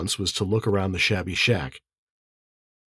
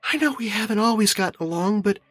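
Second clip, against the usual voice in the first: high, light female voice; narrator's Anna, reconciled; 12 semitones higher than usual. A narrator's reading. Anna smiles about the family, high-voiced and reconciled. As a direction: confide it in a high woman's voice.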